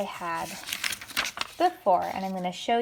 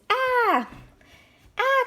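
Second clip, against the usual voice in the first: a sudden very high shriek of alarm; high cry of fright; 4 dB louder than usual. Acted. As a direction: squeal like a startled pig. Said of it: "cried Little Pug and Big Pug".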